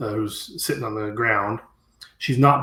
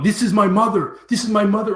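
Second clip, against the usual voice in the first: raised mock yell of an angry villager; 7 semitones higher, 6 dB louder than usual. Villager yelling at the game manager, mild mock yell. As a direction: shout it like an outraged son. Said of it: "And he's just yelling".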